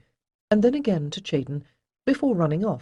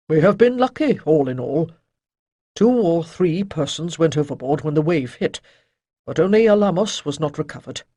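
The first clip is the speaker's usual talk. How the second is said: louder, lightly voiced old master; old sage Chayden; 6 dB louder than usual. A narrator's reading. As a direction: shake off gloom with gruff cheer. Chayden answers grimly.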